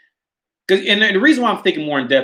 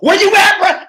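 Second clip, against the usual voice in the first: high, loud call through the pipes; booming protector-mode holler; protective shout; 8 dB louder than usual. Retold or imitated. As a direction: yell out to a stranger in trouble. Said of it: "this is exactly what I did".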